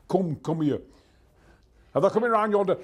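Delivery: lively, colourful Yorkshire dialect; Yorkshire accent. Shown as a demonstration. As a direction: call out in broad Yorkshire. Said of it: "the Yorkshire people have a wonderful colour".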